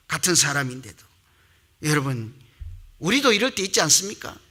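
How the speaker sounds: ordinary talk, nothing marked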